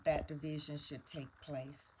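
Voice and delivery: ordinary delivery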